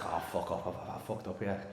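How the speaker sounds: low, quiet muttered realisation; rueful aside. Retold or imitated. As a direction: mutter it to yourself, acting out regret. Comedian's rueful thought, acted out.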